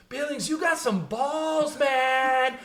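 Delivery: high, slow impressed voice; mock onlooker. Classmates watching, impressed by the challenge. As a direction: drawl it out in awe. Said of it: "they're like".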